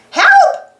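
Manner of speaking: high, loud duck squawk; panicked quacking cry for help; squawking Donald. Acted. Donald's float is on fire: high panicked squawk. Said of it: "squawked Donald".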